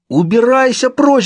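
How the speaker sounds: high, loud, drawn-out scream; shouting. A narrator's reading. Narrator fighting off his own corpse, shouting. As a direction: yell to drive it away. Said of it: "закричал я".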